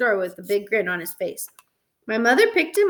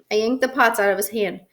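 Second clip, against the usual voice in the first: usual speaking voice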